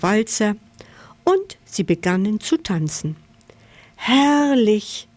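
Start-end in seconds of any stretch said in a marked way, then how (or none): 4.0-5.1 raised, drawn-out cry of delight; delighted exclamation. A narrator's reading. Camel enjoying the waltz, delighted. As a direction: exclaim with high-pitched delight.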